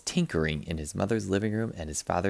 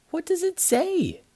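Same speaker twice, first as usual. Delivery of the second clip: put-on high, bright-eyed kid asking; high eager child voice; excited child voice. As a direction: squeak it out like an eager little boy. Child asks eagerly about note.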